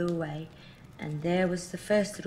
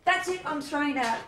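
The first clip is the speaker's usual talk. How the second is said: angry; 8 semitones higher, 4 dB louder than usual. Acted. Mother losing patience.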